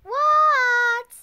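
high, loud shouted answer; loud high call; loud reply. Acted. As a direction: shout back from another room. Mother calls back.

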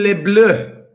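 hammed-up French accent; jokey mock French accent; silly French accent. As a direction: play at being French. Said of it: "this crazy French thing".

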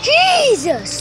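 startled. Real, not acted. Startled by the dog.

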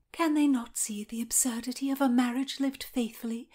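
high, half-whispered fretful muttering. A narrator's reading. Anne mutters to Margaret about her sentence, muttering.

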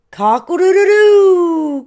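loud, high cock-a-doodle-doo; rooster crow. A narrator's reading. Rooster spots Turkey in disguise, crowing high and loud. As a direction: crow it out with a long last note. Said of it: "crowed Rooster".

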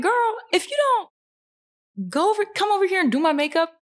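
put-on high-pitched, sassy "girl, come on" protest; mock exasperated retort. Client telling makeup artist to do her job, mock exasperated.

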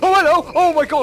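high, loud startled yelp; startled shout. Real, not acted. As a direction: jump out of your skin. Monster appears on screen.

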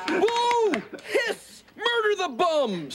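high-pitched jeering heckle; heckling. Acted. Alf boos the challengers Kate and Willie, heckling. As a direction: boo the challengers like a rowdy fan.

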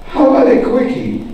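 lightly voiced Patton. General eyeing waitress and menu, lightly acted proposition.

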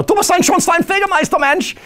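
high mock yell in German; hammed-up shouted German. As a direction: burst in barking German to startle mum. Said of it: "start yelling in German".

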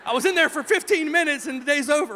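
high, loud mock child's wail; imitated bawling. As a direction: imitate an upset child's protest. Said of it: "She's bawling because she thinks".

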